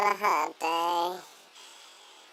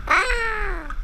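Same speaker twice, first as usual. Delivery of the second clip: high yelp from cold shock; sudden high cry at the cold; shocked gasp; 13 semitones higher, 3 dB louder than usual. Real, not acted. Dropped into ice cold water.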